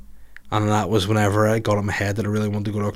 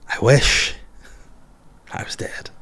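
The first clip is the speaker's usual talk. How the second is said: hushed, breath-only aside; mock despairing whisper; 2 semitones higher, slower than usual.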